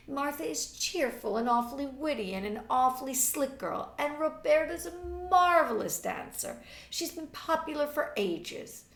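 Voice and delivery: high, loud and insistent; impatient. A narrator's reading. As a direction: object with impatience. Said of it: "objected Marjorie impatiently".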